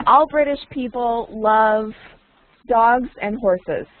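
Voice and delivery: lines "All British people love dogs and horses" spaced out slowly for a latecomer; slow, careful teacher repetition; slow and clear recap. Shown as a demonstration. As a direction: spell it out word by word for the new student. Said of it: "recapping for a newcomer".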